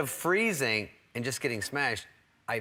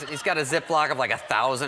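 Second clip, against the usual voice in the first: normal talking voice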